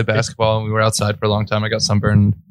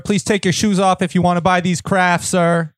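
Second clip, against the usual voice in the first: fed-up barked instructions; 7 semitones higher than usual. Pretend. TSA agent role play again.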